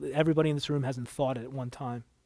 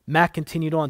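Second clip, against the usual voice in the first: plain reading voice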